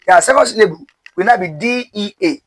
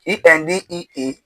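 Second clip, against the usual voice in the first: normal talking voice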